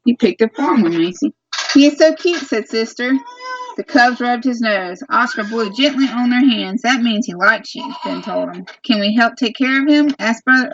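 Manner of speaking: storybook read-aloud voice to little ones. Real, not acted. Mother reading a farm book to her children, storybook voice.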